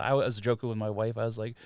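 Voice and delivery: ordinary talk, nothing marked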